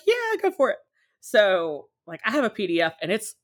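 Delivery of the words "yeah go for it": imitating the friend's carefree yes, much higher; mock breezy permission. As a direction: toss it off lightly, pitched well up. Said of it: "She was like".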